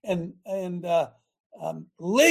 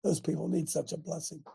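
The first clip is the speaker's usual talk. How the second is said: hushed aside; 5 semitones lower, 10 dB quieter than usual. Retold or imitated. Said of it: "I said".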